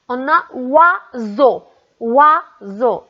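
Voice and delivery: oiseau, with wa drawn out; demo word. Shown as a demonstration. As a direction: say oiseau slowly, stretching the wa. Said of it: "on entend wa au début du mot".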